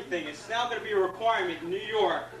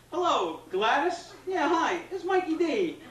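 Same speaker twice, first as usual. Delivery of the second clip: higher, slow mock-sweet phone voice; mock sweet call; 7 semitones higher than usual. Comic phoning a short driver ahead of him, mock-sweet voice. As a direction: fake friendliness that turns rude. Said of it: "It'd be like".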